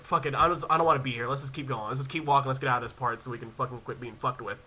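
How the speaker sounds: low, hurried-along grumble to himself; fed-up muttering. Retold or imitated. Visitor urging his sister onward, fed-up muttering. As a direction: drop the voice, just get through this.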